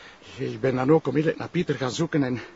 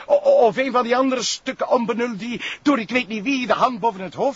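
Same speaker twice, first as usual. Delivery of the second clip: higher, stammering with rage; angry outburst; 7 semitones higher than usual. Acted. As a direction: vent at the prosecutor. Sergeant rants about protected criminals. (woedende uitval)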